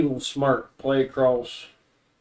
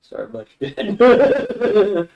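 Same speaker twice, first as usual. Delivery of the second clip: slow, higher, laughing through it; laughing condolence; 8 semitones higher, 8 dB louder, slower than usual. Acted. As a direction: offer sympathy while cracking up. Lord's sideways jab, laughing.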